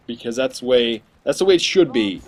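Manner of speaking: ordinary delivery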